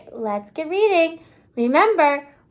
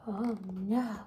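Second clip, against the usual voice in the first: much softer, dropped "oh no" of pretend dismay; hushed oh no; 7 semitones lower, 14 dB quieter than usual. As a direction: breathe it out as if trouble is coming. Mock alarm after the bell.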